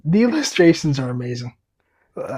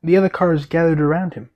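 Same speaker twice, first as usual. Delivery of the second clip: ordinary delivery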